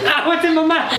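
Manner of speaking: startled cry. Real, not acted. Foam in mouth.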